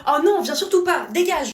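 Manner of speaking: loud irritated shout, pushing him away; loud rebuff. Acted. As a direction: yell at him to get lost. Refus criée au partenaire. (refus crié au conjoint, fort et agacé)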